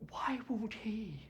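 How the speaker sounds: high, drawn-out mock plea; mimicked lament. Doctor quoting the family's talk of love, mimicked lament. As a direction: echo a lovelorn complaint, slow and high.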